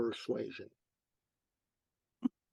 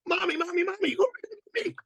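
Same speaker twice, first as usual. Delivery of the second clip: put-on very high, eager child voice; squeaky, excited little-boy impression; 4 dB louder than usual. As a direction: pipe up like a small boy pestering Mommy. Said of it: "in that wonderful way little boys do".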